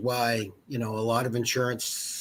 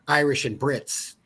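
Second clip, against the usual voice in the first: usual speaking voice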